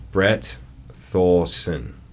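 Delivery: Brett Thorson drawn out word by word; laboriously slow try at an American accent; very slow attempt. Shown as a demonstration. Retrying without his accent, painstakingly slow and stretched. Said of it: "I meant to be slower".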